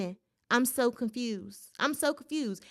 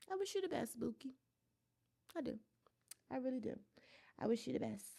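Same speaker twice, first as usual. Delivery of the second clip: soft sarcastic farewell; 15 dB quieter, much faster than usual. Real, not acted.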